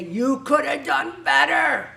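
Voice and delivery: a slow, loud, scolding shout at yourself; inner critic shouting. Retold or imitated. Perfectionist yelling at herself for not doing better. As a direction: hammer each word like a harsh inner critic. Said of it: "You say".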